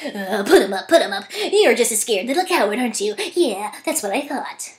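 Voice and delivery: a hint of a blustering lion; lightly voiced lion daring a fight; Cowardly Lion impression. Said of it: "what if I tried to do an impression of that".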